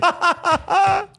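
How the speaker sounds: high laugh right after the line. Real, not acted. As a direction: burst out laughing again. (éclat de rire aigu)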